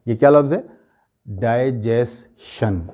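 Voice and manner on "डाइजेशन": "डाइजेशन" very slowly, dai, jes, SHUN; slow three-part "डाइजेशन", die-jes-shun; common mispronunciation. Shown as a demonstration. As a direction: end "डाइजेशन" with sh. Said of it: "ऐसे ही बोलते हैं ना हम लोग".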